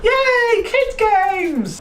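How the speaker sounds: put-on very high squeaky childish "Yay"; mock Nintendo cheer. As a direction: play a gleeful toy company. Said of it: "was like".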